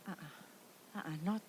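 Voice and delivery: whispered, nearly breathless. Retold or imitated. Wife whispering to husband atop the water slide, hushed. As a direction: hush it urgently to your husband. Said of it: "I whispered to Mac".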